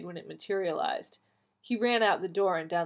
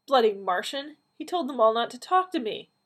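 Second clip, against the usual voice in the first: higher, louder exasperated complaint; indignant outburst; 6 semitones higher, 6 dB louder than usual. A narrator's reading. Donna fuming at the phone, indignant outburst. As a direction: vent with rising indignation. Indignant after being hung up on.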